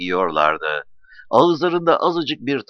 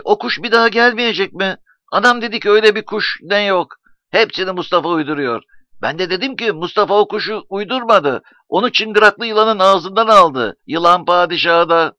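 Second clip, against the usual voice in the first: put-on high-pitched, insistent child voice; excited young boy; 8 semitones higher, 5 dB louder than usual. A narrator's reading. Nuri tells his friends what his mother said, excited boy. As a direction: chatter high and eagerly like a boy.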